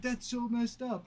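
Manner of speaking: retold offended protest; mock outrage. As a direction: throw it back in fake indignation. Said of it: "he was so mad".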